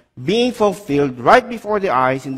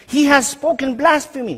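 indignant high priest; 9 semitones higher than usual. Acted. High priest accuses Jesus.

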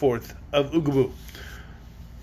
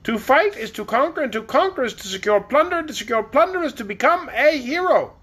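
high, grand regal voice; queen Anne rallying her army with grand pride; haughty queen; 7 semitones higher than usual. Acted. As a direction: proclaim it like a vain queen setting off to war.

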